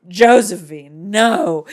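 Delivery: loud, slow, horrified cry of a name; king crying out in horror; shocked king. Retold or imitated. The king sees his dead wife, loud shocked cry. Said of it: "the king's like".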